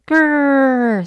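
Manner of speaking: a little dinosaur growl. Acted. George growling at the dinosaur patient, playful growl. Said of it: "said George".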